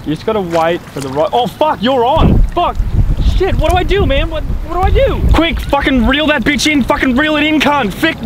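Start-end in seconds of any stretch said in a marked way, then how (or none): 1.3-2.8 sudden excited call. Acted. Fish bites suddenly. 3.4-8.2 panicked high squeals to reel in; frantic high yelling. Acted. Two mates landing a fish, frantic high yelling.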